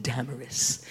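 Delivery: "Damaris" with stress on the first syllable; "Damaris" as DAM-uh-ris; first-syllable emphasis. Shown as a demonstration. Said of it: "has the emphasis".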